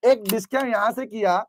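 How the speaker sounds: ordinary talk, nothing marked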